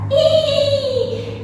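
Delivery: high imitated horse whinny; horse neigh. Shown as a demonstration. Teacher giving children an animal clue, high imitated neigh. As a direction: squeal a long high "eee" like a horse. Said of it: "like this".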